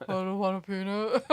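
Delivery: softened, low mock-giant voice; attempted movie giant voice; giant from Princess Bride. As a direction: put on a big deep giant. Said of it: "Something about a peanut in Princess Bride".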